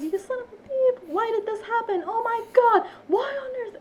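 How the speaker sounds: very high, squealed mock outburst; mock screaming. Retelling a streamer's outburst when shot in game, mock screaming. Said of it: "it's like he's screaming".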